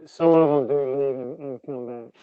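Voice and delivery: words muffled behind a hand. Real, not acted. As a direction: speak through a covered mouth. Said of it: "you're very muffled on that last part".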